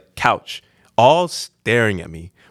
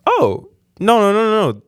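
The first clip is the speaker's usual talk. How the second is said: high-pitched mock protest; imitated girl correcting; 9 semitones higher than usual. Girl correcting confused customer, high-pitched protest. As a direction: wave it away in a high imitated voice.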